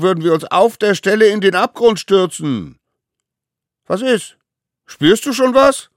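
high, loud playground insults; mocking shouts of a gang of kids; jeering taunts. A narrator's reading. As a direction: hurl the insults across a ravine. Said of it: "gemeinen Worte rüber zu pfeffern".

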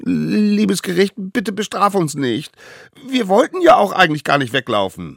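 high, loud anxious begging; frightened pleading. A narrator's reading. Frightened little savages call out to the court, pleading. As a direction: squeal excuses in a frightened child's voice. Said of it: "bekamen die kleinen Wilden ziemlich viel Angst und riefen".

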